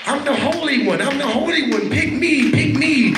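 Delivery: high, whiny mock self-righteous plea; mock holy one. Preacher mocking a churchgoer who thinks they're holy, high whiny plea. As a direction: mimic a show-off begging to be picked.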